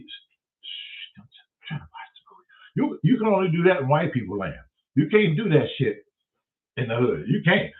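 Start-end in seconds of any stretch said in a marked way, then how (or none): 0.0-3.0 whispered shush. Retold or imitated. Shushing in theater.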